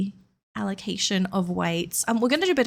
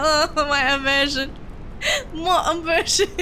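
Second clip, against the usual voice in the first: higher-pitched mock wail, laughing at it; hammed-up whine, rising on the repeat; mock dismay; 6 semitones higher than usual. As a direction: playfully cry out in fake anguish. Mock complaint about thick accent, laughing.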